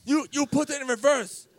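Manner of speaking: high-pitched sputtering accusation; imitated yelling. Driver blaming him after the crash, imitated yelling. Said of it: "starts yelling at me".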